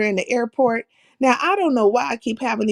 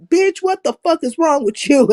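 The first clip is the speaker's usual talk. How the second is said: retold near outburst; 6 semitones higher, 3 dB louder than usual. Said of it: "it almost came out my mouth".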